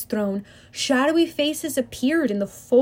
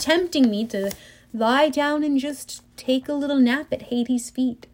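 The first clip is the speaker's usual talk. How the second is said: plain reading voice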